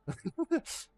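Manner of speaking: high laugh. Real, not acted. Laugh after the mock plea.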